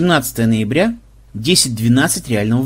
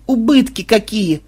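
higher, slow wail on убытки какие; mournful wail; 8 semitones higher, slower than usual. Acted. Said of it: "горестно застонала Мелисса". (горестное причитание)

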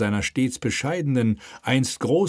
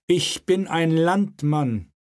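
slow, raised, solemn statement; slow declaration; 8 semitones higher, slower than usual. Acted. King proclaims himself a countryman, slow and solemn. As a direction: declare it like a creed.

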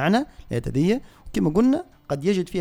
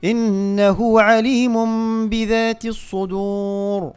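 slow, raised chanted tajweed recitation; Quran recitation; 7 semitones higher, 5 dB louder, much slower than usual. Shown as a demonstration. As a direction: recite the verses slowly in measured tajweed. (تلاوة مجودة بطيئة)

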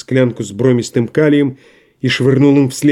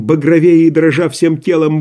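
plain reading voice

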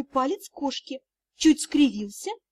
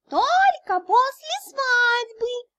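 very high, drawn-out kitten voice; talking kitten; 11 semitones higher, much slower than usual. A narrator's reading. Winged kitten pipes up to the dragon, high and drawling. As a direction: squeak it slowly like a tiny winged cat. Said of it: "маленький крылатый котёнок".

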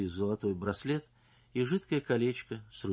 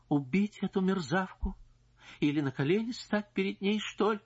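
desperate anguish; 10 semitones higher than usual. Acted. Wife's desperate thoughts.

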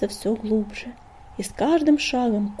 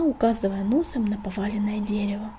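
ordinary delivery, nothing marked

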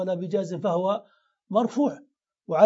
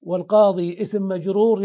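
ordinary talk

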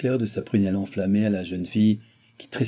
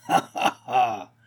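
acted burst of ha-ha laughter; roaring laughter; 3 semitones higher, much slower than usual. A narrator's reading. Baron scoffing at Balsamo's claimed age, bursting out laughing. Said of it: "en riant aux éclats".

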